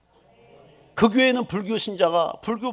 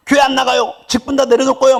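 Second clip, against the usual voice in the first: loud, higher petulant threat; imitating a believer threatening to quit church; mock threat; 7 semitones higher, 8 dB louder than usual. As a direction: threaten God like a spoiled child. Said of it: "협박도 하는 거야".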